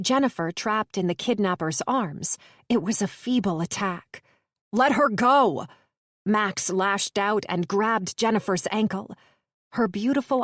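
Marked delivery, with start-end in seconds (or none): 4.7-5.7 higher, loud frantic yell; shout. Acted. Sykes yelling at the kidnapper, frantic shout. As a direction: scream for her release.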